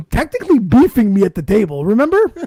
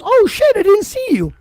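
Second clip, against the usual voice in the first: mock surprise. Retelling Sean's fake surprise.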